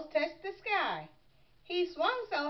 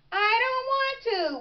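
put-on high-pitched childish whine; whining; 10 semitones higher, 4 dB louder than usual. A narrator's reading. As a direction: whine it like a reluctant little frog. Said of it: "whined Froggy".

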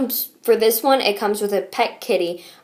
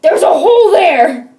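higher, louder, laughing; excited; 7 semitones higher, 8 dB louder than usual. Real, not acted. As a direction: announce it with a delighted laugh. Delighted discovery.

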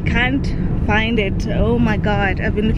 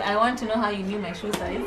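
normal talking voice

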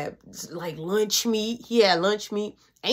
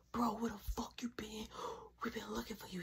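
soft hushed whisper; 18 dB quieter, faster than usual. Retold or imitated. Questioning brother in the toy room, hushed whisper. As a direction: keep it down to a whisper.

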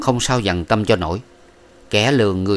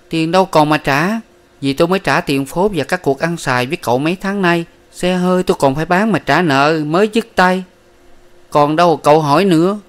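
higher, louder, exasperated; curt refusal; 8 semitones higher, 4 dB louder than usual. A narrator's reading. Miss Ba says no money is left, higher and louder. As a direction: snap that nothing is left.

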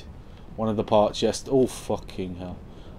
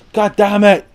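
loud, high curse; frustrated shout; 10 semitones higher, 11 dB louder than usual. Real, not acted. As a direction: burst out in frustration. Frustrated at having no item.

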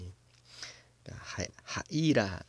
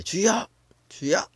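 loud, much higher shouted prayer; crying out in prayer; 11 semitones higher, 7 dB louder than usual. Retold or imitated. Congregation praying aloud, loud high cries of Lord. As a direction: cry out to the Lord at full voice. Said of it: "이렇게 하잖아요".

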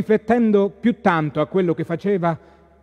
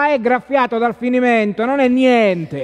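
dismissive reassurance; 7 semitones higher, 5 dB louder than usual. Acted. Ninì brushes off the horse wound.

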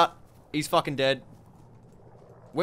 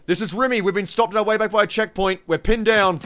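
ordinary talk, nothing marked

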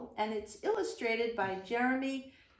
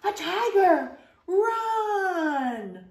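shout; 8 semitones higher, 8 dB louder, much slower than usual. Acted. Sudden tiger scare in story.

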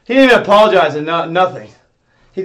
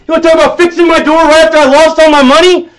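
rapid, high, loud ranting; fast furious shout; 6 semitones higher, 7 dB louder, faster than usual. Real, not acted. Streamer raging that staff bring up his door, fast furious shout. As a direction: spit it out in a fast rage.

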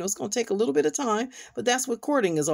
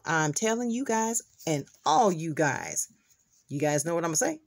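normal talking voice

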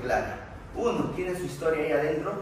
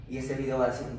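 ordinary talk

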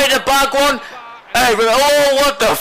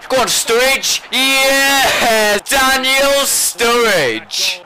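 cheering; 5 semitones higher, much slower than usual. Real, not acted. Cheering his striker on.

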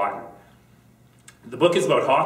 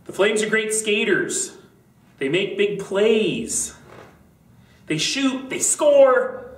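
mom cheering; 10 semitones higher, 3 dB louder, slower than usual. A narrator's reading. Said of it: "His mom said".

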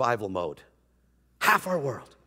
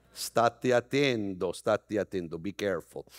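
mock Italian warning; 9 semitones lower, 4 dB quieter than usual.

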